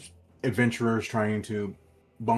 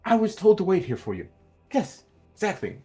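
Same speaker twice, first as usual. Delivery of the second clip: high, quick, louder steward voice; helpful steward; 10 semitones higher, 8 dB louder, much faster than usual. Acted. Steward hands waiting adventurers their receipt, high and quick. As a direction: hurry it out in a high-pitched character voice.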